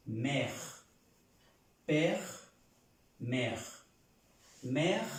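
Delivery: "mère" and "père" as single open-è syllables; French pronunciation demo. Shown as a demonstration. Teacher contrasting the M and P words, French pronunciation demo. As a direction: alternate "mère" and "père" with clear pauses. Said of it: "Y si es madre con la M".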